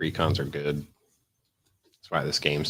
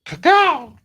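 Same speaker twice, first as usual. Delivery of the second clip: crow call; 11 dB louder than usual. Pretend. Mock crow call to chat.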